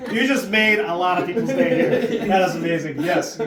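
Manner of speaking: laughing. Real, not acted. Host delighted by the accent.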